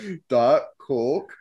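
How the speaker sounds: "Diet Coke" slowly, imitating a Canadian accent; mimicked Canadian pronunciation.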